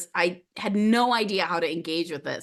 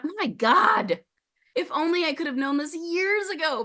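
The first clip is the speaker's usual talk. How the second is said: much higher, disbelieving outburst; exasperated relief; 11 semitones higher than usual. Retold or imitated. Speaker realizing she isn't unhinged, high relieved outburst. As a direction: let it spill out like years of worry just lifted.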